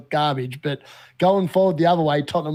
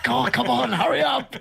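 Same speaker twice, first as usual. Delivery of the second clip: high, laughing mock impatience at the screen; a touch of frustrated pleading, pitched up; impatient urging; 7 semitones higher than usual. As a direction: hurry the lagging stream along, half laughing. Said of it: "I'm like".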